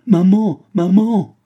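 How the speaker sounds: put-on high, loud child's cry; child screaming for his mother in pain. A narrator's reading. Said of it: "Yanko cria".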